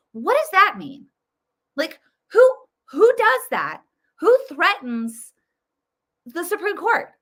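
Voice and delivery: real outrage at the president's threat; incredulous. Real, not acted. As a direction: ask it in sheer disbelief. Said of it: "incredulous rant".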